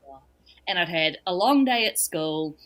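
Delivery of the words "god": dreading groan. Retold or imitated. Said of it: "And I went".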